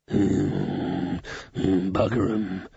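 put-on low muttering old beggar; foul old Ron. A narrator's reading. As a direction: grumble it from deep down like a mad old tramp. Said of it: "said foul old Ron".

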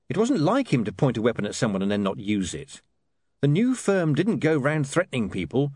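ordinary delivery, nothing marked

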